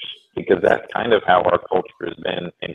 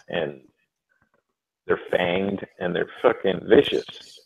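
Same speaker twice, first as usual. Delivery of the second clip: ordinary talk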